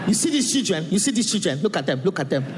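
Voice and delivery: imitating a Nigerian mother's warning; mother's Nigerian accent. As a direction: warn the kids in mum's Nigerian accent. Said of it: "And she'll be like that".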